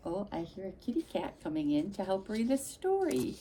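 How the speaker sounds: plain reading voice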